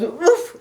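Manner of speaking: high, loud oof as he hits the floor; oof on landing. Acted. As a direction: grunt from the fall. Elephant falls on bottom.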